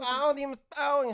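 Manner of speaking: high, loud, slow mock Vietnamese accent; Vietnamese vendor accent. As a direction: call a customer over in a hammed-up Vietnamese accent. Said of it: "and they turn around".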